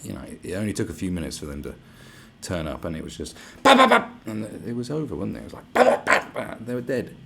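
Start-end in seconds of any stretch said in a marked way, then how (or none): 3.6-4.1 loud, high voiced gunshot noises; imitating police shooting the attackers; mock gunfire. 5.7-6.5 breathy unvoiced gunshot noises; whispered gunfire. Imitating gunfire again.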